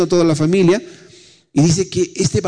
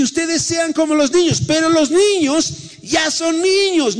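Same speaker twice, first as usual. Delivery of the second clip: high emphatic; 14 semitones higher, slower than usual. Acted.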